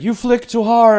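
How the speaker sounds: very high, loud squeal; mock swooning lady. Mocking the ladies watching Zeus strip, high squeal. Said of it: "it's like".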